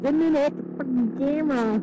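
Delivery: startled. Real, not acted. Startled, bumped camera.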